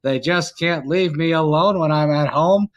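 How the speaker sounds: sportscaster calling a run in a measured, punched cadence; old-time play-by-play voice, hitting each phrase; Howard Cosell. Acted. Mock broadcaster calling the game for friends, Howard Cosell impression.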